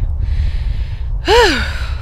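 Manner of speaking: big exhale to settle down; composing sigh; long sigh. Real, not acted. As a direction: sigh out the last of the laughter. Composing self after laughing.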